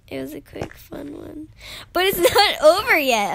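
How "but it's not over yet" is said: loud, higher, with a laugh inside; excited announcement. Real, not acted. Gamer telling viewers it's not over, excited announcement. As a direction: burst out gleefully. Surprising viewers after fake outro.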